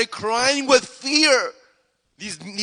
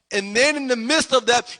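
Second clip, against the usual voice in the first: usual speaking voice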